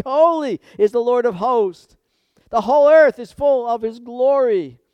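loud, high ringing proclamation; angels crying holy. Acted. Seraphim crying to each other before the throne, loud and ringing. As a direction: call it out like the seraphim. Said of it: "they're saying".

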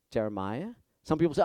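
ordinary delivery

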